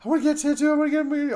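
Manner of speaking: high, rushed, eager wife voice; imitating his wife. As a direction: gush it out fast and high like an excited wife. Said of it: "She'll go to this thing".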